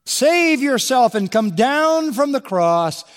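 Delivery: high, slow sneering jeer; mocking taunt. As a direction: drag out the jeer with contempt. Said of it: "That's all mockery".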